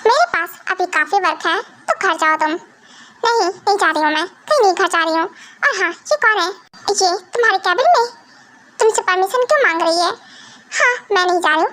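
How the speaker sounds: normal talking voice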